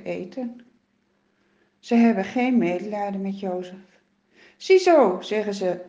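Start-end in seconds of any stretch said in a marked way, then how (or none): 4.6-5.2 1 mocking. Brothers mocking Jozef.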